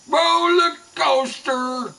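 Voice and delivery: high, slow, drawn-out roller coaster; mock catchphrase. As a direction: stretch it out high, mocking. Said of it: "Am I supposed to fucking do those".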